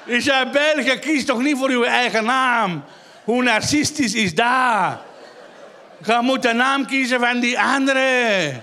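high, drawn-out exasperated reproach; hammed-up indignation; mock indignant scolding. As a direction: scold with exaggerated disbelief, stretching the last word. Mock scolding of audience member.

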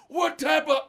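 high, loud outraged question; imitated angry dad. Dad reacting to accident excuse, loud mock anger. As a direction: start a furious demand as dad. Retold dad demanding answer.

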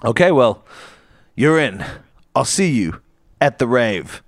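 usual speaking voice

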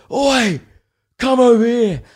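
loud high call across the street. Retold or imitated. Retelling the stranger's shout to come over. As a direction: yell him over, imitating the guy.

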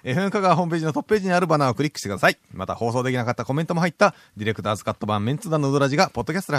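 announcement read. Acted. Reads the show information.

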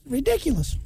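high squeaky cartoon voice; Mickey Mouse voice. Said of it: "Sounds like Mickey Mouse".

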